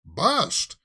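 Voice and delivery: "Bust!" tossed up high with a rising lilt; mock-dramatic exclaim. Real, not acted. Vlogger hyping the scandal to viewers, mock-dramatic. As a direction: punch out "Bust!" like a tabloid hook. Echoing headline for effect.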